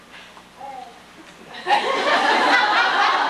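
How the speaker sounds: high, loud laugh at the failed tape; real amused laughter. Real, not acted. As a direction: crack up as nothing plays. Tape fails to play.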